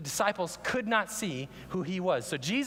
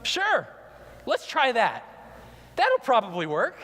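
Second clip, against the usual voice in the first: 1 mock eager agreement; 5 semitones higher, 5 dB louder than usual. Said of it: "And they go".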